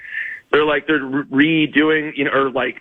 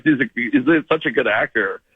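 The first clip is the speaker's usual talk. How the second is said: ordinary talk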